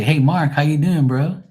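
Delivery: mock standard American. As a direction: mimic a chummy American hello. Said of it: "We don't talk like that here".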